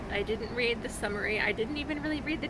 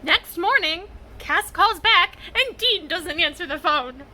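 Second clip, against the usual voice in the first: loud, high, exasperated rush; exasperated outburst; 6 semitones higher, 10 dB louder than usual. Real, not acted. Reader yelling at the plot twist, loud and exasperated. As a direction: shout it out in disbelief. Excited reaction to fanfic plot.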